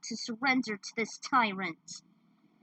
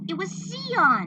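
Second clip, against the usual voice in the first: higher, louder, slow delight; joyful exclamation; 8 semitones higher, 8 dB louder, slower than usual. Acted. Narrator revealing the rescuer, slow joyful exclaim. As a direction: announce the rescue with joy.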